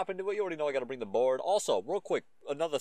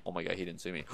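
normal talking voice

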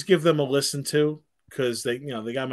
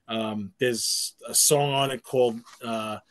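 ordinary talk, nothing marked

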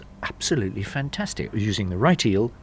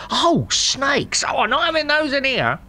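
much higher, louder, horrified squeal at the eels; shocked landlord's wife; 4 dB louder than usual. Retold or imitated. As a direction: mimic a scandalised landlady recoiling from snakes. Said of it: "she'd go".

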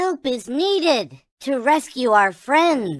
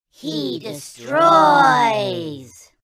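ordinary talk

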